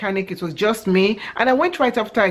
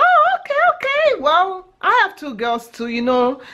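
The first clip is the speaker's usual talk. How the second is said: eager man's voice; 10 semitones higher than usual. Retold or imitated. The guy at the mechanic answering her, high eager voice. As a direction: play the overeager flirt.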